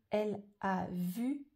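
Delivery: word-by-word, slow and deliberate; measured reading with gaps between words; slow dictation. Shown as a demonstration. Teacher giving pupils the phrase to write, slow dictation. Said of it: "Alors la phrase c'est".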